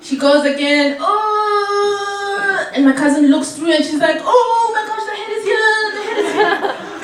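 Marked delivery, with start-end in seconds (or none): 1.0-2.7 a high sung-out moan of pain; labor moan. 4.2-7.0 higher, half-shouted "the head is here"; excited alarm.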